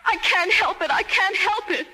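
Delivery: anguished outburst. Acted. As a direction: voice rising high and loud in anguish. Camden cries out about Benji.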